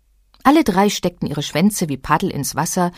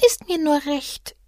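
slow, soft, much higher sighing little creature; 9 semitones higher, 4 dB quieter than usual. A narrator's reading. Lonely little animal sighing at the travellers, soft and slow. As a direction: let it out on a sigh. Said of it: "seufzte das kleine Tier".

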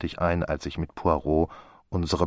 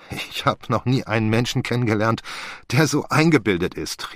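higher-pitched amused exasperation; half laughing, half annoyed; 6 semitones higher, 4 dB louder than usual. A narrator's reading. Friend to the conceited detective, half amused and half annoyed. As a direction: call it out in mock indignation. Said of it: "rief ich halb lachend, halb ärgerlich".

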